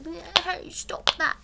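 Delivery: high, silly attempt at Cockney; bashful Cockney impression. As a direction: put on a bad, bashful Cockney squeak. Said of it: "Cockney accent without something, sounding stupid".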